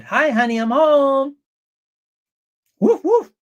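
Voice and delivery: mock husband greeting. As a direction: bark it like a cartoon dog. Mock husband coming home.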